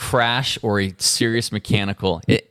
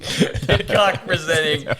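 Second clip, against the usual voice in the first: laughing; 7 semitones higher, slower than usual. Pretend. Repeated through laughter.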